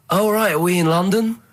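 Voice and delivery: usual speaking voice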